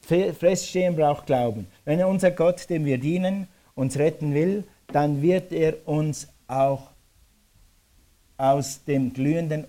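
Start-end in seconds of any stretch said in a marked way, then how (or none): none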